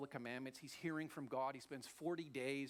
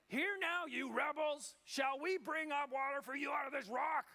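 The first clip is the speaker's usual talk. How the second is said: high, loud furious yell at the rebels; angry shout; 10 semitones higher, 5 dB louder than usual. Acted. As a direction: bellow at them in rage. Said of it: "Here's his tone, his voice".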